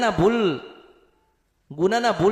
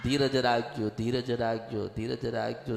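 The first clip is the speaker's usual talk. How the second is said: each repeat softer, dying into a near hush; fading soft repetition; 8 semitones lower, 8 dB quieter than usual. Acted. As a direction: let the blessing fade softer and softer. Repeated blessing, fading away. (દરેક વખતે વધુ ધીમા અવાજે, ઓગળતું આશ્વાસન)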